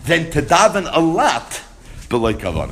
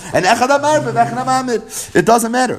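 raised, louder insistence that it doesn't matter; emphatic reassurance; 9 semitones higher, 3 dB louder than usual. Retold or imitated. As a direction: press the point home, higher. Rabbi Yochanan consoling, raised pitch.

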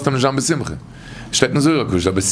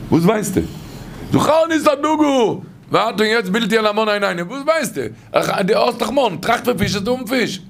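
retold quote pitched much higher, pushing back at the other man; mock indignant retort; 9 semitones higher, much faster than usual. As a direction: play the scoffing voice of the man in the story. Acted argument, retold quote.

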